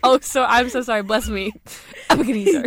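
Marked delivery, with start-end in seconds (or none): 2.1-2.7 Ebenezer as a louder sneezed "Ebekeneezer"; mock sneeze. Host begs a bless-you, Ebenezer as mock sneeze. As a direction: sneeze out Ebenezer as Eb-eh-kuh-NEE-zer. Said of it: "That sounds like you sneezed".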